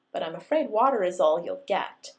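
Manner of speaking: loud, firm brush-off; firm polite refusal. A narrator's reading. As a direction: shut him down politely. Belle rejecting jock.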